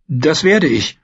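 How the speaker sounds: a hint of a brave boy; lightly voiced boy, holding up. Bran promises the maester, lightly voiced. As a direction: force out the promise. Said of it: "obwohl es ihm schwer fiel".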